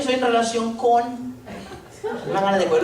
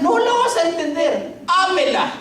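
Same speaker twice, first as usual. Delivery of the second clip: fervent preaching; 8 semitones higher than usual. Real, not acted. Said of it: "preaching to husband".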